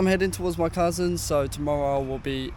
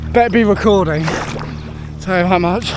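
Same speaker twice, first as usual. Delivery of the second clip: breathless loud shouting; 3 semitones higher, 9 dB louder than usual. Real, not acted. Still in cold water.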